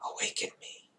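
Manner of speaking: "Awaken me" whispered, unvoiced and soft; sighed whisper. A narrator's reading. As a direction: sigh it out half-conscious. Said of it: "once again it sighs".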